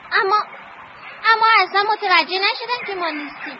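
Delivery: ordinary talk, nothing marked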